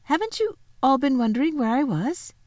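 put-on high, puzzled little-girl voice; amazed. Lucy surprised nobody missed her, amazed. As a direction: ask in wide-eyed astonishment. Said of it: "said Lucy in amazement".

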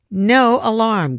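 sharp, slow and loud; exclaiming. A narrator's reading. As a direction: stop them cold with it. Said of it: "Griffin exclaimed".

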